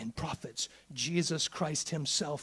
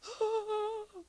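mock gasp. Acting panicked colonist.

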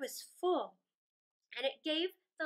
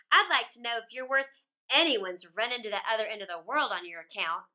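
put-on louder, bold teasing robber girl; brash robber girl; 4 semitones lower, 6 dB louder than usual. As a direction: tease him like a cocky bandit girl. Robber girl teases Kay.

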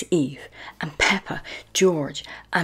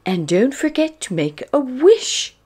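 higher, louder lilting granny; Granny Pig; 9 semitones higher, 9 dB louder than usual. A narrator's reading. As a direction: encourage a grandchild in a raised voice. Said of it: "Granny Pig says".

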